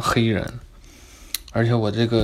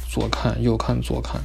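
ordinary delivery

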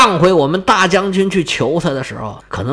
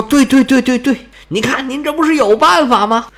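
eager agreement; 9 semitones higher, 3 dB louder than usual. Acted.

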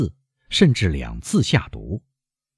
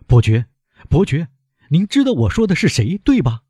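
high, urgent exclamation; agitated, high-pitched appeal; crying out; 9 semitones higher, 3 dB louder than usual. A narrator's reading. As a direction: call out insistently, pitch climbing as you go. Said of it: "喊道".

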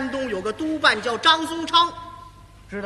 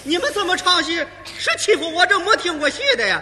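put-on indignant old lady; 5 semitones higher, 5 dB louder than usual. Old lady accuses the troupe.

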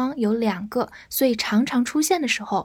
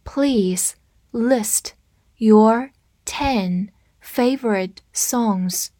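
word by word with long gaps; slow read-through; much slower than usual. Shown as a demonstration. Teacher modelling the favorite-songs request for learners, slow read-through. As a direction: say each word slowly and separately. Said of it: "我们慢读一遍".

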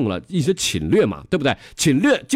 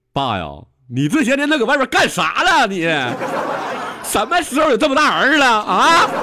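假装委屈的儿子; 10 semitones higher, 4 dB louder than usual. Retold or imitated. 老师装儿子质问老头.

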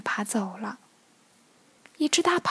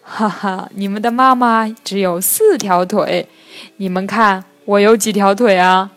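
loud mother crab with an acted ha-ha; amused; 2 semitones lower, 9 dB louder than usual. A narrator's reading. Crab mother correcting little turtles, loudly amused. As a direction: chuckle out the words, then explain loudly. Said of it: "笑着说".

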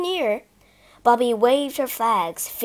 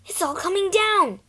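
higher, a touch of tremble; shaky voice; 8 semitones higher, 3 dB louder than usual. Acted. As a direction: speak shakily. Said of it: "said Peter in a shaky voice".

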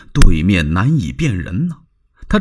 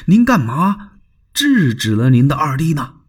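pointed question, a little higher; probing Dong Cheng; 4 semitones higher than usual. Acted. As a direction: press him for an answer. Dong Cheng voiced in story. (追问)

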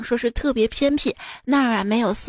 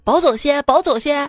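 put-on pushy Xi'an-accented haggling, a bit louder and higher; Xi'an dialect hawker; 3 semitones higher, 4 dB louder than usual. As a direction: repeat it like a vendor pressing a sale. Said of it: "一个商贩向老外兜售".

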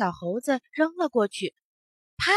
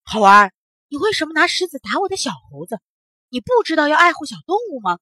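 fast, raised-pitch scolding; indignant, huffy questioning; 4 semitones higher, 6 dB louder, faster than usual. A narrator's reading. Old monkey scolding the boy over his little monkey, huffily. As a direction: call him out loudly, then rattle off the reproach. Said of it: "老猴子气呼呼地问".

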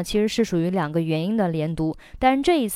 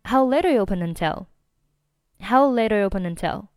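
"How late are you open until" run together quickly; brisk connected speech at natural speed; fast linked demo; faster than usual. Shown as a demonstration. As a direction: rattle it off as one linked phrase. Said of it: "整句话就变成了".

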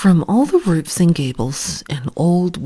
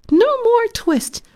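tiny mouse voices, much higher, shouted; twittering mouse shout. A narrator's reading. Little mice shouting at Simpkin through the window, high squeaky cry. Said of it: "shout at once in little twittering voices".

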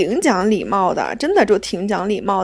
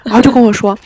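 usual speaking voice

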